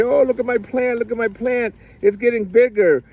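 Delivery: high-pitched imitation of thrilled young gardeners; excited kids. Youth showing the teacher their growing plants, excited. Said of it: "they're, like, so excited".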